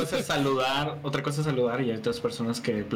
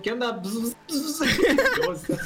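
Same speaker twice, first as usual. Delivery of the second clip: laughing greeting; 10 semitones higher, much slower than usual. Retold or imitated. Greeting the renamed streamer.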